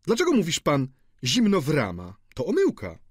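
high, mock-pedantic correction; jocular student. A narrator's reading. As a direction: correct him with playful indignation.

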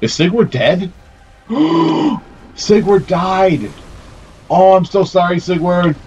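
horrified when the ally falls; shocked dismay. Real, not acted. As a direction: gasp at the ally's death. Ally dies in the fight.

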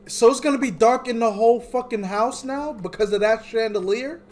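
high, loud frustrated complaint; exasperated rant. Real, not acted. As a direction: vent at the game loudly. Complaining about darkness.

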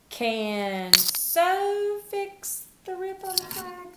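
high, slow, silly wrong reading; mock wrong answer. Shown as a demonstration. Teacher tries the wrong word so children hear the mistake, high and slow. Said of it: "That doesn't make sense".

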